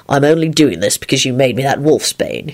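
low, mildly cross. A narrator's reading. Lupin tucks in Neville grudgingly, cross. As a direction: grumble it low. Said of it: "he said crossly".

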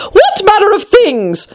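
high, curious queen voice. Acted. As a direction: peer over and ask sharply. The queen asks.

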